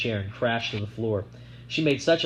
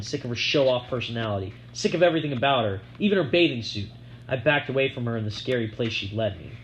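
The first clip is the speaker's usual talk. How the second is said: ordinary delivery, nothing marked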